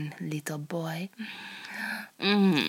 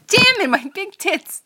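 real exasperated cry, higher and louder; frustrated outburst; 13 semitones higher, 10 dB louder than usual. Real, not acted. Performer exasperated at the script's wording, loud and annoyed. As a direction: burst out, fed up with the word.

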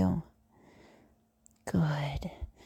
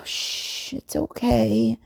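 normal talking voice

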